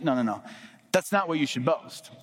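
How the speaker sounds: usual speaking voice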